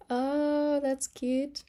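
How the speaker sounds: usual speaking voice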